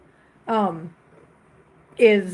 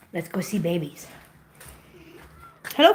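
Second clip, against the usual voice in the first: ordinary talk, nothing marked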